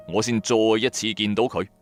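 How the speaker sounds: usual speaking voice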